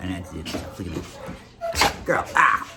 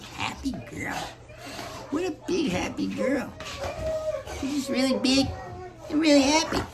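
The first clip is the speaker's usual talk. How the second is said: lightly voiced dog, higher and excited; voicing the dog; 10 semitones higher, 5 dB quieter than usual. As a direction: play the dog gushing, a hint of squeal. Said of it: "She's like".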